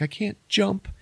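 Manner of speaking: high, wailing panicked woman; hammed-up falsetto wail; mock panic. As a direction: imitate her pleading for her life. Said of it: "she still panics".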